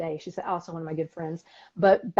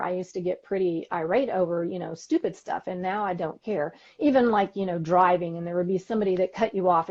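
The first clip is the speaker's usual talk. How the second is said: usual speaking voice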